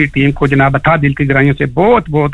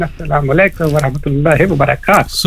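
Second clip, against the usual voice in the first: usual speaking voice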